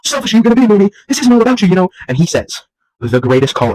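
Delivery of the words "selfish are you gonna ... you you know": high, hammed-up indignation; mock scolding. Host scolding the movie for being selfish, mock indignation. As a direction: tell it off in a raised, put-on voice.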